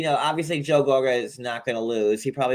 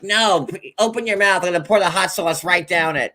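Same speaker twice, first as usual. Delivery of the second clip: higher-pitched, insistent retold command; playfully imitated demand, pitched up; mock bossy order; 5 semitones higher than usual. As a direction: act out a pushy woman ordering someone. Retelling Jennifer pushing hot sauce.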